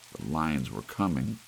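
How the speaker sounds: usual speaking voice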